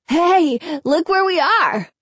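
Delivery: high, surprised exclamation. A narrator's reading.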